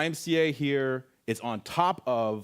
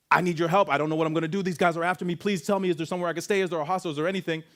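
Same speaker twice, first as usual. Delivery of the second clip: higher, urgent begging for shelter; anxious pleading; 6 semitones higher, much faster than usual. Retold or imitated. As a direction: plead with the shopkeepers. Pleading to shopkeepers.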